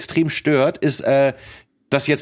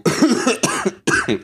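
sudden coughing fit; choking cough; 8 semitones higher than usual. Real, not acted. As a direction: cough after swallowing wrong. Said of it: "Alter, verschluckt".

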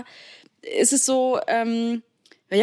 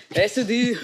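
bursting out laughing loudly; loud laugh that breaks off the words; 4 dB louder, slower than usual. Real, not acted. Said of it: "das war sehr laut". (lautes Auflachen)

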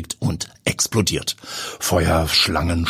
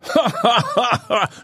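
amused laugh. Acted. Pilot laughs at her panic.

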